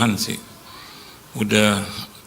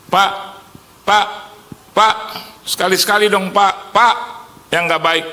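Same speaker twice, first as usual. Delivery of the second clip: pleading; 9 semitones higher, 4 dB louder than usual. Retold or imitated. Questioner begging for gossip.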